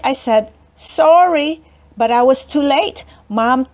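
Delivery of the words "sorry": quick sorry. A narrator's reading. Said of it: "So real quick".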